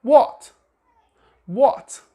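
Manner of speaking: what with a rounded British vowel, lifted. Shown as a demonstration. Teacher repeats the British what. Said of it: "the word".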